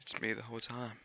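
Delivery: ordinary talk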